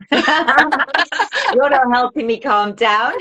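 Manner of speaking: laughing, slow mock complaint; half-hearted grumble through laughter; mock anger, laughing. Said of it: "They are angry".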